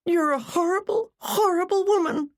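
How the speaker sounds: high, strained outburst of hurt fury; high-pitched, wounded spite; spat in tearful anger. A narrator's reading. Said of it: "she spat".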